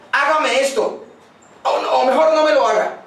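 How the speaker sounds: a parent who can't decide, half-acted; confused parent orders. Inconsistent parent giving then withdrawing an order, mildly voiced.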